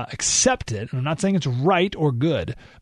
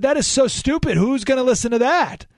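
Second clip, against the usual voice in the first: frustrated; 8 semitones higher than usual. Retold or imitated. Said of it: "super frustrated".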